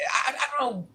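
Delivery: fighting back real tears; choked up. Real, not acted. Telling the story of the kind dentist, choking up with tears. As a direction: voice cracking into a sob. Tearful moment recalling the dentist.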